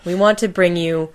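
ordinary delivery

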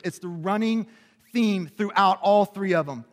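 ordinary delivery

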